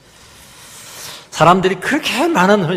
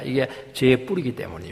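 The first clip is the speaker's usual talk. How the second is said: ordinary delivery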